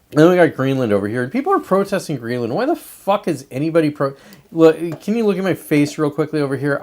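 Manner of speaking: ordinary delivery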